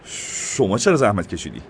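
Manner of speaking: butter up the mayor; simpering sycophant voice; fawning. A narrator's reading. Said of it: "نوری چاپلوسانه گفت".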